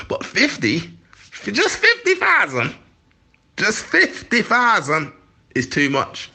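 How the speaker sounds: very high, drawn-out disbelief; mock the fuss over fifty thousand; incredulous mockery. Real, not acted. Mocking the anti-migrant fuss to the interviewer, very high and incredulous.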